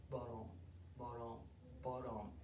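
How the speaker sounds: very low, soft tortoise steps; low, quiet plodding sound. Acted. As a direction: murmur heavy little footfalls. Both friends plodding along.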